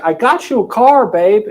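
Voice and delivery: beaming husband voice; proud gift. Retold or imitated. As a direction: show off the gift.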